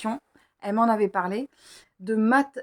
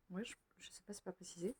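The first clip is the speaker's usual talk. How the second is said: very soft, half-whispered aside; muttering to herself; 22 dB quieter, faster than usual. Real, not acted. Reader checking the book's imprint, muttering to herself. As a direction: think aloud under your breath.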